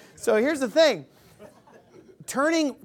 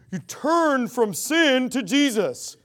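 slow, mocking Southern preacher; lightly parodied Southern twang; Southern accent; much slower than usual. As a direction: drawl it like a Southern false-gospel preacher. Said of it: "I always do a Southern accent".

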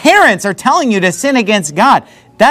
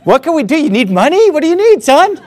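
eager parents; 6 semitones higher than usual. Retold or imitated. Parents eagerly offering help.

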